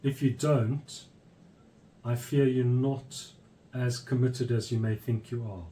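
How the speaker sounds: normal talking voice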